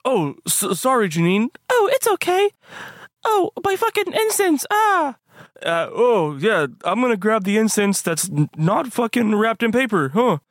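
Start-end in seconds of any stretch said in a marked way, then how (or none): 1.7-5.2 character voice. Acted. High character voice.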